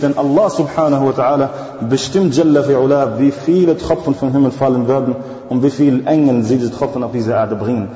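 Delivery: usual speaking voice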